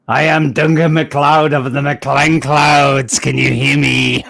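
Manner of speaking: slow, drawn-out attempt at a Scottish accent. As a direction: lay on a Scottish accent, slowly. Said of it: "Have I gotta say it in a Scottish accent?".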